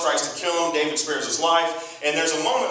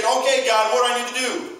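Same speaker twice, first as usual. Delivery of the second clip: high, loud, urgent appeal; desperate plea; 8 semitones higher, 4 dB louder than usual. Acted. Saul about to get destroyed, desperate plea. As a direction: call up urgently for an answer.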